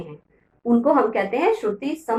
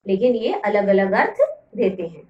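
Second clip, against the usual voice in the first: normal talking voice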